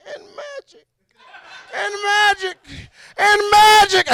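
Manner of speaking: high far-off answer growing to full voice; swelling echo. Acted. Hidden players answer the comic, swelling echo. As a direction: start high and faint, swell each repeat. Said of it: "First pussy echo".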